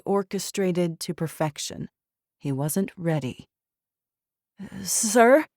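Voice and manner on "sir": high, nervous stammer; timid, scared to interrupt. Acted. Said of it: "Baxter stammered".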